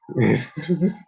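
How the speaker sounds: sudden high laugh out loud; loud surprised laugh; burst of laughter. Real, not acted. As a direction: laugh hard at the clip. Laughing at clip moment.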